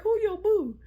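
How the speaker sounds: high, startled "who your boo?"; surprised question. Retold or imitated. Coworker asks who her boo is.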